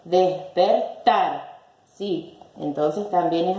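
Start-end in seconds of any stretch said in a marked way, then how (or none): 0.0-1.6 slow syllables, "Despertar" stressed on final tar. Shown as a demonstration. Teacher finding the tonic syllable, "Despertar" stressed on tar. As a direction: break up "Despertar" and land on TAR. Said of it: "la que suena más fuerte".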